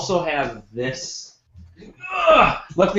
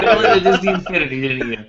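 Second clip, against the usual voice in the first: laughing in disbelief; amused laugh through the words; 3 semitones higher than usual. Real, not acted.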